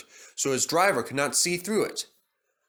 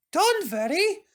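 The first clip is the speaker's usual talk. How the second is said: much higher gentle comfort; soothing. A narrator's reading. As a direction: soothe your upset friend. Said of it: "soothed Peter Sam".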